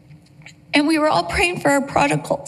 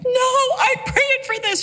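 joyful; 9 semitones higher than usual. Retold or imitated. Answering daughter's apology joyfully.

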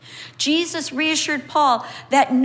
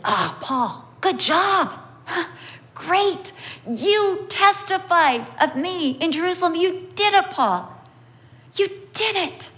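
higher, gentle praising voice; Jesus praising Paul; 6 semitones higher than usual. Retold or imitated. Preacher voicing Jesus praising Paul, higher and warm. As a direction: voice Jesus proud of Paul, lifted up high. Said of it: "But Jesus says".

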